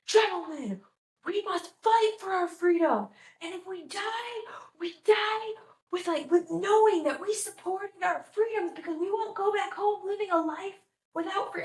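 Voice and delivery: high, impassioned mock battle speech; hammed-up rallying cry to the troops; Mel Gibson rallying troops. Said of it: "like, rallying them".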